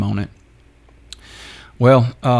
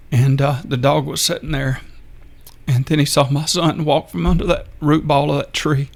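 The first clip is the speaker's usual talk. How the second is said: real choked-up telling, pitched higher; getting emotional; 5 semitones higher than usual. Real, not acted. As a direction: fight back tears while telling it. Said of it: "I apologize for getting emotional".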